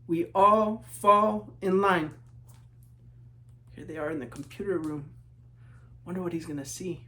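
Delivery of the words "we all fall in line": slow, even, marching chant; slow refrain. A narrator's reading. Refrain of the marching class, slow chant. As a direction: drag it out in step like a marching line.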